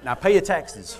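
loud, high joking proclamation; mock official welcome. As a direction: belt it out as a comic welcome. Jokingly voices greeting to new citizen.

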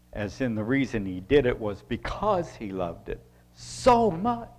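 ordinary talk, nothing marked

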